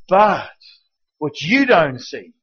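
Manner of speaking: usual speaking voice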